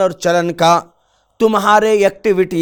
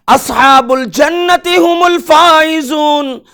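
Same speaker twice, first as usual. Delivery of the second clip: proclaimed verse; 8 semitones higher than usual. Acted. Verse called out.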